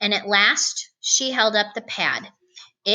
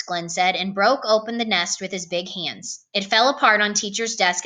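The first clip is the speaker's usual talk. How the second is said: ordinary delivery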